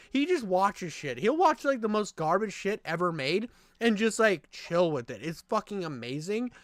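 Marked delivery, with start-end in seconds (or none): none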